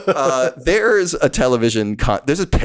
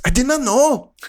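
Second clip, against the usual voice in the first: higher, over-the-top lament; mock dramatic character; 9 semitones higher than usual. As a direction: wail it as the shocked heroine. Repeating character line higher.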